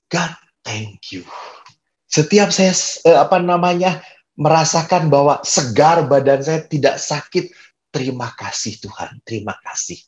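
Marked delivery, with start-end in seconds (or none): 0.1-1.3 slow, soft, lowered thanks; grateful prayer. Retold or imitated. 7.9-10.0 soft, low thanks to God; a hushed, intimate thank-you in prayer; grateful prayer. Retold or imitated.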